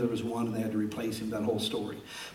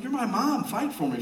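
put-on pleading kid; 10 semitones higher than usual. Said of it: "I'm like".

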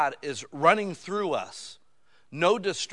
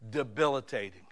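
normal talking voice

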